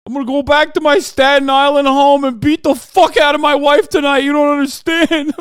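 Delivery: hammed-up wailing New York cop, high and shouted; New York cop accent. Host as crying cop apologizing to Trump, shouted New York accent. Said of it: "wouldn't have that kind of accent".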